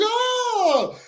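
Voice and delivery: long high wailing shriek; imitated woman's drawn-out scream; high, held mock wail of alarm; mock frightened scream. Retold sudden scream of woman.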